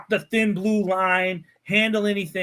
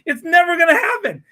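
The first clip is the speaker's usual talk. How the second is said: louder, laughing through "never gonna happen"; laughing dismissal; 8 semitones higher, 7 dB louder than usual. Real, not acted. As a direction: laugh it off loudly.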